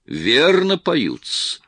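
slow, high, smiling approval. A narrator's reading. The actor defends the singer, smiling. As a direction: say it with a pleased smile. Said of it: "сказал, улыбаясь".

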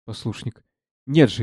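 ordinary delivery

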